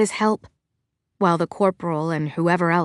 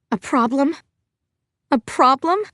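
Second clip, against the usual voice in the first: incredulous; 5 semitones higher than usual. A narrator's reading. To the corporal, repeating his question in angry disbelief. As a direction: throw the question back, higher each time.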